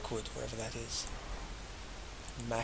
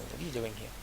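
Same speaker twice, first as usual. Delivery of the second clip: ordinary talk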